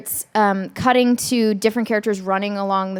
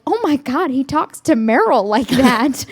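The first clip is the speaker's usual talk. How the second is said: high, amazed "oh my God"; mock scandalized whisper-gossip tone; shocked gossip; 5 semitones higher, 4 dB louder than usual. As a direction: gasp it like a shocked crew member. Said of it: "they were like".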